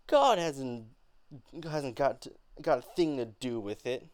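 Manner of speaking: loud, halting defiance; angry. Acted. Beneatha defying Mama about God, angry. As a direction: spit it out in anger. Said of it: "angrier tone once Benita began talking about God".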